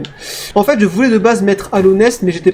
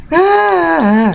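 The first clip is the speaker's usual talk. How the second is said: dismayed cry; 9 semitones higher than usual. Real, not acted. Another fall in game.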